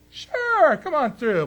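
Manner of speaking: high, loud, cheery mock welcome; mock eager reply. Military answering Iran's ships, in a high, loud, cheery mock welcome. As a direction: play the eager gatekeeper waving them through. Egypt's military waving them through.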